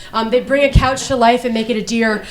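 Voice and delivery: ordinary delivery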